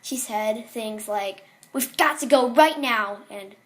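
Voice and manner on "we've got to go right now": loud, pressing urgency; raised, urgent voice; urgent mother. Acted. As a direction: insist like a mom hurrying a child out. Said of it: "never heard such urgency in her voice".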